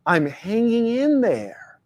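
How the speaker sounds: slow, drawn out, weary; resigned stock reply. Retold or imitated. Speaker mocking the habitual weary answer. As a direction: sigh it out like an old habit. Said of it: "culturally programmed responses".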